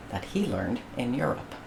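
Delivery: ordinary delivery, nothing marked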